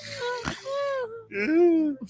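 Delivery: squealing high laugh; wheezing high-pitched laugh; high laughing fit. Real, not acted. Laughing at own joke.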